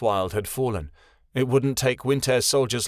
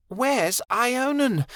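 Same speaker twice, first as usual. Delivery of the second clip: high, slow, desperate repeat; frantic; 13 semitones higher, much slower than usual. A narrator's reading. Kia demanding again where her son is, frantic. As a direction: press him, frantic for an answer. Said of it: "her voice frantic".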